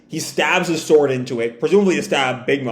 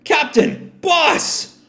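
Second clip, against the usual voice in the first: worried calling; 12 semitones higher, much slower than usual. Acted. Crew calls their captains.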